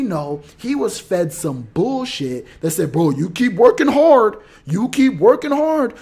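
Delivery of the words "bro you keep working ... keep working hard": mock pep talk. Bosses' empty motivational pep talk.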